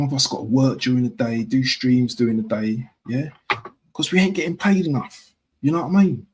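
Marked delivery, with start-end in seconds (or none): 3.9-6.3 put-on higher-pitched Cockney accent; playful Cockney grumble, high in pitch. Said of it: "That was my Cockney accent".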